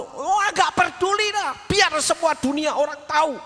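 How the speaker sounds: imitating a hysterical angry person, high-pitched; mock hysterical anger. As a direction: act the screamer who doesn't care who hears. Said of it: "ini orang marah dengan tipe histeri".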